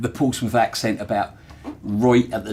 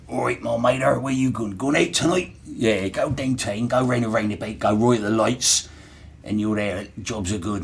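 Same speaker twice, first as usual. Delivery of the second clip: Portsmouth dialect. Retold or imitated. Recalling how locals spoke, mild Hampshire burr. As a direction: roll out the old Pompey dockyard talk. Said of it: "the way they would pronounce things".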